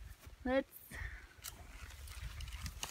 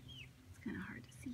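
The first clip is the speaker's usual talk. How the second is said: hushed near the spider; whispering; 22 dB quieter than usual. Real, not acted. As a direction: whisper so it won't flee. Close to a spider.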